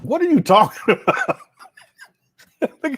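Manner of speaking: incredulous. Real, not acted. Reacting to lip-puckering clip.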